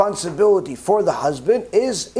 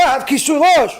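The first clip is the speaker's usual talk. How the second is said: higher, louder indignant protest; insistent protest; 7 semitones higher, 4 dB louder than usual. Retold or imitated. Woman protesting to the heavenly court, indignant. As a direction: insist you did everything right.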